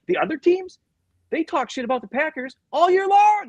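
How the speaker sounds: high, loud imitation of an indignant player; worked-up complaint. Player on rivals trash-talking the Packers, worked up. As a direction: climb high and push it loud to the end.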